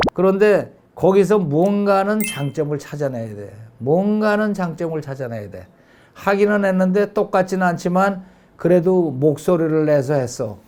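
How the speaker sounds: ordinary delivery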